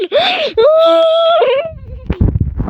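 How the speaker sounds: long high shouted gasp; crying gasp. Real, not acted. Player watching the area close, long high gasp. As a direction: wail a gasp of alarm.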